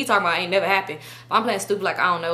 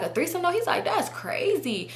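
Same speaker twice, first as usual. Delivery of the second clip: high, hushed mock disbelief; mock incredulous; 9 semitones higher, 5 dB quieter than usual. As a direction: mimic him scandalized, soft and high. Mimicking his scandalized reaction.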